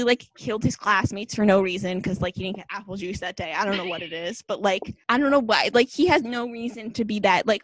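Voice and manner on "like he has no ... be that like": usual speaking voice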